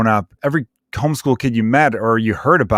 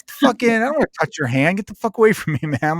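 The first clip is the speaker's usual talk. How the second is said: high-pitched, exasperated brush-off; mock disgusted refusal; 8 semitones higher than usual. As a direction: push the guy away with a half-joking high yelp. Recoiling from a handshake offer.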